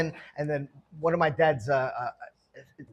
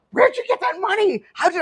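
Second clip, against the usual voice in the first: high-pitched, louder mock astonishment; incredulous parents; 14 semitones higher, 5 dB louder than usual. Parents confront son over stack of hundreds, incredulous. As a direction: imitate parents demanding an explanation.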